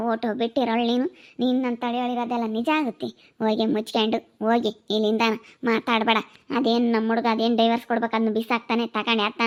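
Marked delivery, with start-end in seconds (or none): none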